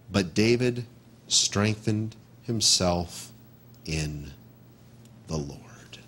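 reverent slow reading. Shown as a demonstration. Pastor reads the verse to the congregation, fading into a whisper. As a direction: let the verse sink to a hushed whisper.

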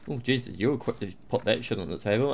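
ordinary talk

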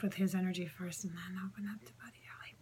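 fast whisper; whispered aside. Real, not acted. Medium telling viewers how she connects, whispered. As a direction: rush it out under your breath.

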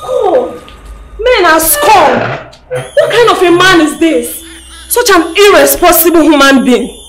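loud, high, indignant ranting; outraged condemnation. Acted. Bystander condemning an irresponsible man, loudly outraged. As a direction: denounce him at full voice.

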